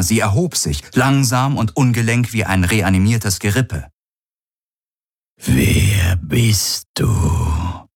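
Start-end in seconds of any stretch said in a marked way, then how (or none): 5.4-7.9 slow, drawn-out eerie murmur in the mind; the alien murmurs slowly into his mind. A narrator's reading. Creature asks Galen who he is, slow eerie murmur. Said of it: "durch seinen Verstand raunen".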